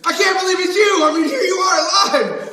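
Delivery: loud, high, laughing joy; overjoyed shout. Acted. Peter to Jesus, thrilled and laughing out loud. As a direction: burst out with delight.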